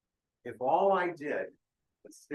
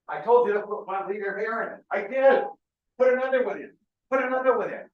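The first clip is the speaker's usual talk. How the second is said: loud, high-pitched mock argument; exasperated shouting; 7 semitones higher, 9 dB louder than usual. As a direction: bark insistent orders in a hammed-up exchange. Acted exasperated back-and-forth over the balloon.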